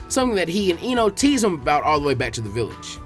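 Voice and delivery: ordinary delivery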